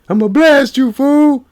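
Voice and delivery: very high, loud cartoonish threat; Donkey-like voice. Scaring a burglar away, squeaky Donkey-style threat. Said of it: "Said he sound like Donkey from Shrek".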